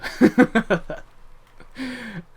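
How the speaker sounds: loud, high real laugh; high burst of laughter. Real, not acted. Bursts out laughing after rant.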